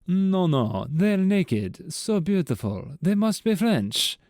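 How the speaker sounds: high, swooning French voice; French accent. Acted. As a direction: play the Frenchman, rapturous. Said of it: "The Frenchman responded".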